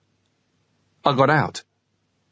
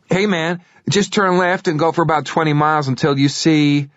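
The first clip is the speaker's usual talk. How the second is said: 1 cocky driver; 6 semitones higher than usual. Acted. Cocky driver giving directions.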